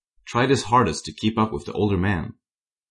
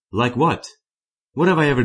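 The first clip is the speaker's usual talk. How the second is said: higher, louder angry demand; furious demand; 6 semitones higher, 4 dB louder than usual. A narrator's reading. Jordan demanding answers from Alex, angrily raised. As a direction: push back hard, indignant. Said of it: "demanding answers as he furiously said".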